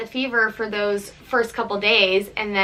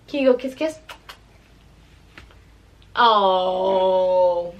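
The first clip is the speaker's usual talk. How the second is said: normal talking voice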